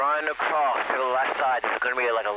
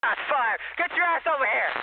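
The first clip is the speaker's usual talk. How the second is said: high urgent yell; shouting; 13 semitones higher than usual. Real, not acted. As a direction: yell it out under fire. Under fire, yelling for help.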